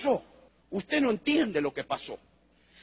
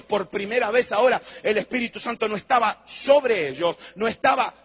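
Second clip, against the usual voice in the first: fervent preaching; 3 semitones higher, 3 dB louder than usual. Acted. Rising preaching climax.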